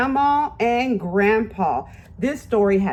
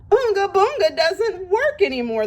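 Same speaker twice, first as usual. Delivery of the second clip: dismayed chorus; 11 semitones higher than usual. A narrator's reading. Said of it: "they all said".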